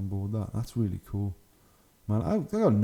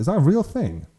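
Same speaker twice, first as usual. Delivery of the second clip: surprised disbelief; 8 semitones higher, 7 dB louder than usual. Real, not acted. Surprised at a ridiculous model.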